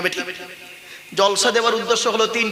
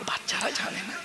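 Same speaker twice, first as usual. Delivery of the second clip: whisper; 3 semitones higher, 11 dB quieter than usual. Real, not acted. Aside to audience, voice drops.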